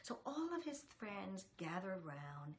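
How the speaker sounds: usual speaking voice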